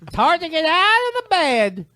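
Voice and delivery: put-on high, slow old man voice; creaky old man, slow and whiny. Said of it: "Do one of your funny voices".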